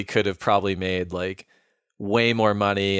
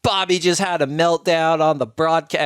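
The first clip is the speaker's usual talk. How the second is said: high, louder breaking-news outburst; alarmed retelling; 8 semitones higher, 5 dB louder than usual. Retold or imitated. Media person telling of the broadcast meltdown, mock alarm. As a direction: blurt it out like urgent bad news, imitated.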